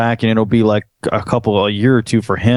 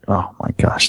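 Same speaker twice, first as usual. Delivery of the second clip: whispered aside; 3 semitones lower, 4 dB quieter than usual. Real, not acted. Exasperated aside.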